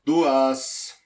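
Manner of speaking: loud correction called to the bar; calling out a correction. Acted. Pedro loudly corrects the drink order to the bar. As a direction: bark the number across the room.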